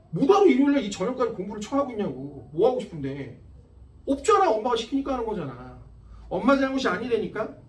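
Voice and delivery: loud and high, yelling at the class; exasperated teacher shouting a lecture; fierce scolding. Real, not acted. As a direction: rant at them, voice cracking high on each question. Teacher scolding students' aimless studying.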